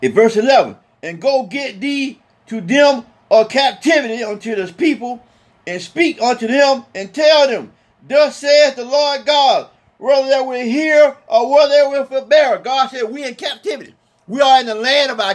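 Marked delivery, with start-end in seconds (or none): 1.0-12.6 slow, measured King James cadence; solemn scripture reading. Acted. Preacher reading Ezekiel aloud to his congregation, slow and solemn. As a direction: intone the verse with weight.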